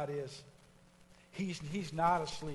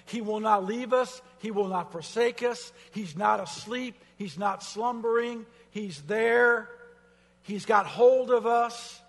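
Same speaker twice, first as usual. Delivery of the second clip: loud, higher, insistent lines; full-force preaching; 4 semitones higher, 8 dB louder than usual. Acted. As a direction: drive it home to the church.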